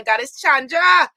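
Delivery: Goddess Chandra called out loud, Guyanese scolding tone, falling hard on Chandra. Shown as a demonstration. Teasing a viewer the Guyana way, loud Guyanese scolding call. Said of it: "like we say in Guyana".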